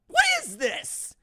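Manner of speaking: high, slow, drawn-out what is this; mock disbelief. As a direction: whine it like you can't believe it. Mock outrage at Plains division.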